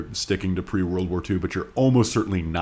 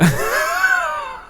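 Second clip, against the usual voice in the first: high squealing burst of laughter; shrieking laugh; 3 dB louder than usual. Real, not acted. Cohost shrieks with laughter at the letter, shrill. Reacting to Jesus Built My Hot Rod.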